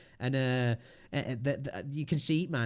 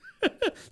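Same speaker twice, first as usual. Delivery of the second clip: short burst of high laughter. Real, not acted. Streamer cracking up at his billionaire impression, high laugh. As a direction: laugh it off, much higher.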